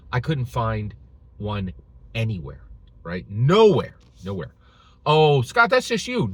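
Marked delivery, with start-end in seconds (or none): 3.3-4.0 loud emphatic "Nowhere". Real, not acted. 5.1-6.3 mock doubter. Mocking a doubter's reply.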